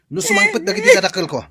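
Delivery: loud, high scornful laughing taunt; mocking dismissal. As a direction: laugh her off the doorstep. Lover mockingly throws her out.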